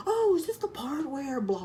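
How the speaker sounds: high, loud imitation of an irritating kid; annoying movie spoiler. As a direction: interrupt in a mock-eager high voice. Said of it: "and he was like".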